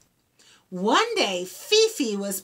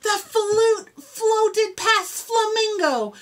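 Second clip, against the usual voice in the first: usual speaking voice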